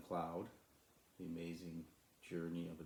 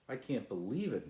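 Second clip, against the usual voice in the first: raised, louder burst of amazement; excited shout; 6 semitones higher, 7 dB louder than usual. Acted. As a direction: cry out in wonder at the stream. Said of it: "shouted Sly".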